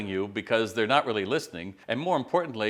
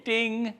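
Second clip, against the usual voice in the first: mock notification ding; 12 semitones higher than usual. Imitating a phone notification.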